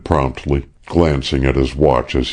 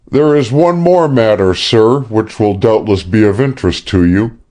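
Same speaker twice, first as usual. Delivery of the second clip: higher, careful, apologetic report; 6 semitones higher than usual. A narrator's reading. Sexton to his boss Pennington, apologetic. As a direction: ease the bad news in, apologizing. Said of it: "Sexton continued apologetically".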